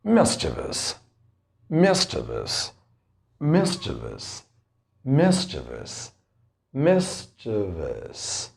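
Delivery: slow, careful "mischievous" as MIS-chuh-vus; teacher modelling "mischievous" for learners to repeat; model pronunciation. Shown as a demonstration. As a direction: drill "mischievous" syllable by syllable, stress on MIS. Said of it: "The first word for today is".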